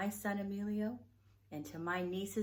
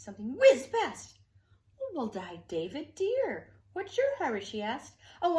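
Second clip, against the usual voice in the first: lively, animated read-aloud voice with big swings; storybook reading; 3 semitones higher, 6 dB louder than usual. Acted. Reading Betty Bear's birthday story to children, animated storytelling. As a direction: read it like a bedtime picture book for little ones. Reads a picture book aloud.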